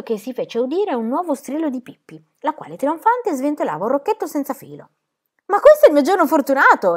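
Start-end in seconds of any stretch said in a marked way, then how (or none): 5.5-7.0 loud, high delighted cry; triumphant shout of joy; excited exclamation. A narrator's reading. Pippi waves the spool, loud and triumphant. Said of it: "esclamò".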